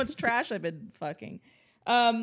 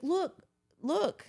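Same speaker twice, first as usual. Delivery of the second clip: put-on high urgent girl; 8 semitones higher than usual. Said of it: "she's like".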